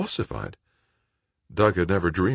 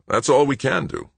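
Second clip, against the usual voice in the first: gruff; 10 semitones higher, 5 dB louder than usual. A narrator's reading. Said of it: "almost gruffly".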